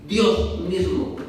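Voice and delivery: slow, weighty Dios mismo; stress on Dios mismo. Shown as a demonstration. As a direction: lean hard on Dios mismo, word by word. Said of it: "escúchame".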